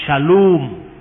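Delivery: "Shalom" with a long, drawn-out O; "Shalom" dengan O panjang. Shown as a demonstration. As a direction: stretch the O in "Shalom". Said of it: "Jadi O-nya yang panjang".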